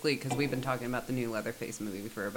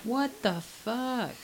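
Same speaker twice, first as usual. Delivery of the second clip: shocked; 11 semitones higher, much slower than usual. Real, not acted. Reacting to grisly story.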